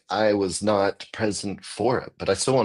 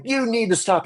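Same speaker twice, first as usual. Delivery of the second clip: very high, squeaky imitation of the warning; mock high warning. Comedian mocking the boyfriend's warning, very high squeak. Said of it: "So it's just like".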